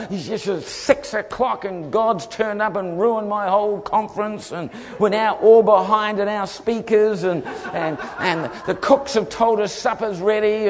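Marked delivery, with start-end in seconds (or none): 0.1-7.2 high, hammed-up fretting; distraught organizer. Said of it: "oh, he was a mess". 8.2-10.7 high, hammed-up fretting; distraught organizer. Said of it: "oh, he was a mess".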